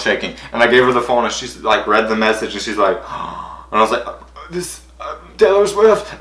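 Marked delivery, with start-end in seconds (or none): 3.0-3.7 mom's gasp. Retold or imitated. Mom reads the message. 4.5-6.1 stunned excitement. Retold or imitated. Showing mom the message.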